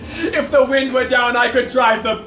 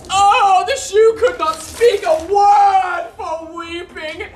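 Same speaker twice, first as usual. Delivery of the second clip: mock weeping; 6 semitones higher, slower than usual. Launce acting out his father's tears, laughing mock wail. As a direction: ham up the tears through laughter.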